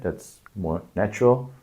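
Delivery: ordinary talk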